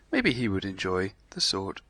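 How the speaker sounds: usual speaking voice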